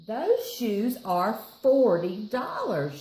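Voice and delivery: slow, loud, stern; firm mom. Acted. Mom telling Dad the price, firm and slow. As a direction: spell out the price firmly. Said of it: "she says".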